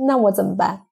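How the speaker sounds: normal talking voice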